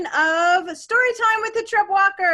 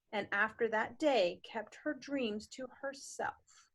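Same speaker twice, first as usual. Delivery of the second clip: whispered, much softer; 6 semitones lower, 12 dB quieter than usual. A narrator's reading. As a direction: breathe it out as a secret. Sad line read hushed.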